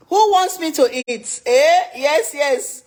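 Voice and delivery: high and loud, thrilled; excited hype. Real, not acted. Host hyping live viewers to let her eat, thrilled. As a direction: shout it to the chat with glee.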